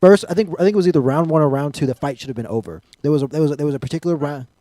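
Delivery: loud, high fired-up rant; excited rant. Real, not acted. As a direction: rant about the fight loudly. Ranting about fight stoppage.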